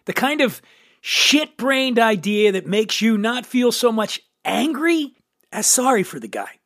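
normal talking voice

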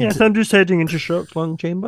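put-on posh caller voice. Mock phone call voice.

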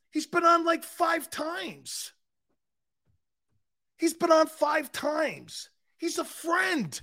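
very high, drawn-out protest; incredulous protest. Acted. Host defends his friend to critics, high-pitched and incredulous. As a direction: squeal it slowly in disbelief.

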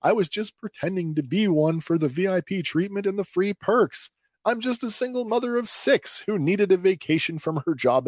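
high-pitched, pleading confession; frantic pleading. Acted. Pretend officer begging off, confessing.

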